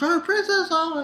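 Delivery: high exclaimed oh, princess; high alarmed oh, princess; mock worried cry. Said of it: "and it was like".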